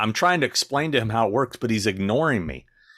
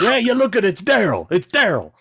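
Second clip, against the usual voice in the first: much higher and louder, starstruck calling out; mocking the eager fan; 14 semitones higher, 7 dB louder than usual. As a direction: squeal for his idol like the pushy fan.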